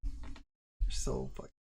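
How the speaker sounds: slow breathy whisper; husband whispering back at wife, slowly; whispered retort. Retold or imitated. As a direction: hush it out slowly. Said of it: "And I'm like".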